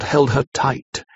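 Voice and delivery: ordinary delivery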